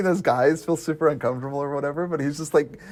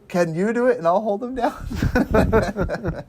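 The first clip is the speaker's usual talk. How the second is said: slow laughing quote; 7 semitones higher, much slower than usual. Acted. Quoting the movie while laughing.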